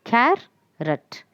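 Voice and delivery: "carrot" slowly broken as car, pause, rot; split into syllables. Shown as a demonstration. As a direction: draw out "carrot" in two separate beats, car and rot. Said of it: "here we have two syllables".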